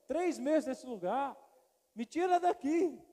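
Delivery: slow, drawn-out plea rising high at the end; pleading prayer. Retold or imitated. Young pastor begging God to get him out, slow plea. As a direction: beg God slowly to be taken away.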